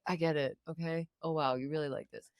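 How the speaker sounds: low, quiet, unimpressed aside; deadpan and fed up, under the voice; soft, dry, over-it delivery; weary acknowledgement. Retold or imitated. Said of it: "you're just like".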